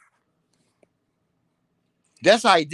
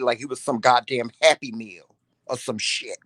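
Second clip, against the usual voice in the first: normal talking voice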